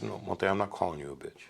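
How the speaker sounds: hushed, unvoiced reassurance; retold whisper. Big man whispers to calm an angry Monte, hushed. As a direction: whisper it to settle him down. Calming a man about to fight.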